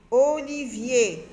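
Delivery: "Olivier" slow, each syllable held; drawn-out syllables. Shown as a demonstration. Teacher checking the word with children, "Olivier" slowly sounded out. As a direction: stretch out every syllable of "Olivier".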